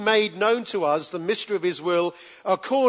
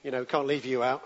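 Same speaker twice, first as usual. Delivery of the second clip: lightly voiced bored God picking people, low and quiet; a casual shrug of a voice, mildly mocking; a hint of a careless king choosing his team; mock offhand God; 5 semitones lower, 4 dB quieter than usual. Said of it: "It wasn't like God sat up in heaven".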